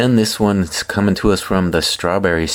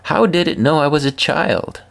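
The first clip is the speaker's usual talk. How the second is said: put-on child voice; 8 semitones higher than usual. Nephew quoted in amazement.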